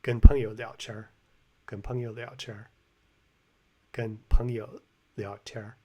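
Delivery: 聊天儿 ending in an R-coloured "tiar"; erhua ending. Shown as a demonstration. Repeating the clip for viewers, 聊天儿 with erhua R. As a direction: repeat the phrase, curling 天儿 into an R. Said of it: "He says 聊天儿".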